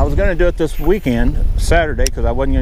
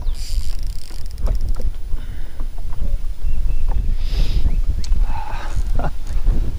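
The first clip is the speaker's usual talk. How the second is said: long breathless laugh; real helpless laughter. Real, not acted. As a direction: laugh it off in disbelief at the bad luck. Laughing at bad luck.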